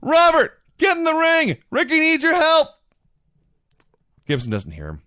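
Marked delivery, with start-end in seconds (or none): 0.0-2.7 high, loud desperate shout; frantic yell. Retold or imitated. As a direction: yell at a partner from ringside. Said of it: "Crockett's yelling at him".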